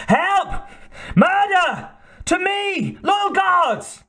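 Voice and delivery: very high, desperate screams for help; an old king begging guards to save him; frantic cries for help. A narrator's reading. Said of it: "Osric cried in vain".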